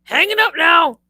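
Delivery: high, loud abrupt grandpa voice; mock grandpa hanging up; grandpa's curt sign-off. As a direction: clip it off like a gruff old man ending the call. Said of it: "he would just go".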